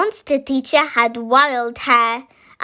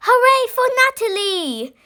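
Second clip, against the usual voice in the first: high cheering shout; the whole crowd roars her name; 11 semitones higher than usual. A narrator's reading. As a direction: monsters cheering high and bright. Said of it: "shouted all the monsters".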